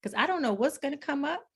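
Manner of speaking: high, anxious mock protest; nervous cast member. Cast member dreading the hot seat, mock nervous and high. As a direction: lightly play a wary teen mom balking.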